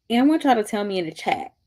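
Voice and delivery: ordinary talk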